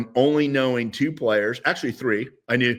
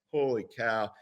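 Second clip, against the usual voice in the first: hushed, softer mock amazement; stunned onlookers; 7 dB quieter than usual. Stunned onlookers voiced after pause.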